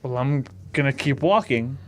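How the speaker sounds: ordinary talk, nothing marked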